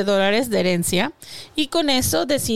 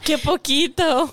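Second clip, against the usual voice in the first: mock pity; 4 semitones higher, slower than usual.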